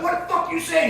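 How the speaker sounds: high-pitched confrontational retort; imitated indignant challenge; mock angry challenge. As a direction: mimic an angry dad squaring up, pitch jumping high. Dad's angry challenge, retold.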